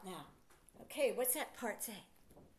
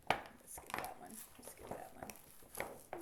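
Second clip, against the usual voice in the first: whisper; 5 semitones lower, 11 dB quieter, slower than usual. Real, not acted. Teacher's aside to herself.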